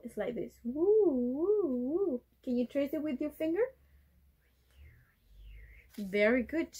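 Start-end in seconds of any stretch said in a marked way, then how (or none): none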